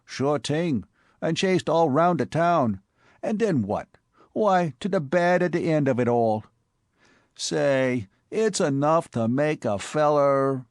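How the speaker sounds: put-on Bowery boy Spike. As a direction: play the excitable Bowery tough.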